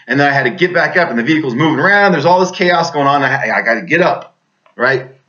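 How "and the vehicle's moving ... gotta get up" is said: normal talking voice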